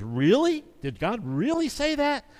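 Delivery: a hint of a sneering serpent, pitch rising; mocking serpent. Serpent taunting Eve about God's command, lightly mocking. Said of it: "You kinda hear the mockery and sarcasm".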